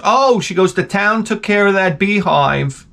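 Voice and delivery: lightly voiced chirpy crossing guard.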